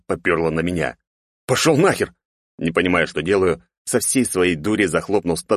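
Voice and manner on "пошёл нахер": frightened shout. Acted. Yelling at approaching zombie.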